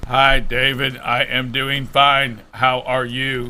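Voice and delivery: low, slow robot voice; deep mechanical robot voice. Acted. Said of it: "You're giving me the robot voice".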